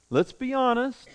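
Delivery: ordinary talk, nothing marked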